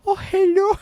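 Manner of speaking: high, put-on Chinese-accented greeting; imitating the woman's Chinese accent; mock Chinese accent. Friend mimics the woman's new accent, mock Chinese accent. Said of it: "Just in a Chinese accent".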